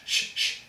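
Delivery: a whispered, drawn-out sh, sh; sh sound demo. Shown as a demonstration. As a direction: hush out the sh sound. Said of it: "it's a S H".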